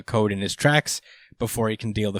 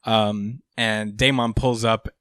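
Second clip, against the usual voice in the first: ordinary talk, nothing marked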